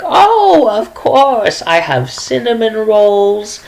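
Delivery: put-on high sweet old lady voice, gushing over her baking; a chatty granny showing off recipes; sweet old woman. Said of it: "she is like".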